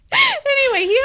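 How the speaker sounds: laughing through the word. Real, not acted. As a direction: crack up at the wrong name. Laughing at misread name.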